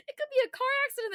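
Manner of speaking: high, fast and loud cheerful news read; chirpy newscaster. Shown as a demonstration. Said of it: "It still sounds so chirpy".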